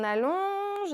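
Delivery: high, stretched-out exercise cue; slow, lengthened, lifted instruction; drawn-out cue. Shown as a demonstration. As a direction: draw the word out like the stretch. Cueing a long stretch in exercise.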